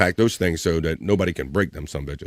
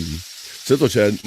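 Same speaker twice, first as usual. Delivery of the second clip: ordinary delivery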